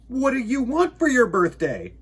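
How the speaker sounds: high, eager bear voice; curious little bear; Bear's question. Acted. Bear asks the moon.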